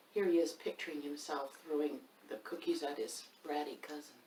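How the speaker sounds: plain reading voice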